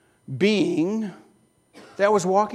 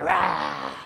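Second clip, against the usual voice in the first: a short growled roar like a hungry lion; 6 semitones lower than usual. Pretend. Preacher roaring like the lion in the den.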